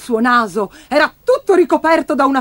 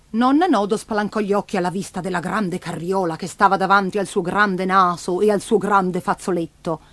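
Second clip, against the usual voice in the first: ordinary delivery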